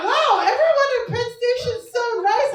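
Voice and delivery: loud, high, clueless cheer; naive brother. Retold or imitated. Brother praising Penn Station, voiced loud, high and clueless. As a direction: gush like a naive kid. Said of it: "He's like".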